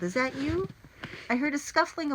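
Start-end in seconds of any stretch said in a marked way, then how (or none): 0.0-0.7 high questioning call. A narrator's reading. Boy checking who is on the roof, questioning call. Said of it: "I called".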